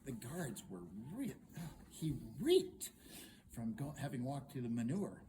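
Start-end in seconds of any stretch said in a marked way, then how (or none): none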